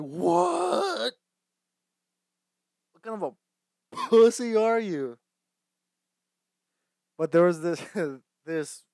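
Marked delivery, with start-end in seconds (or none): 0.0-5.2 high, loud, drawn-out disbelief; incredulous outburst. Acted. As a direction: stretch it out in mock outrage. Acts out disbelief at the movie.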